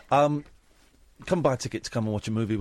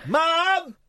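high, loud wailing call to mum; whiny tattling little sibling; 5 dB louder than usual. Retold or imitated. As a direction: cry out like a kid telling tales. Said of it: "she's looking at me".

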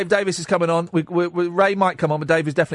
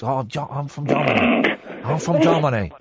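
clumsy attempt at a German accent; bad German accent; 2 semitones lower than usual. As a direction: try and fail at sounding German. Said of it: "trying to do a German accent".

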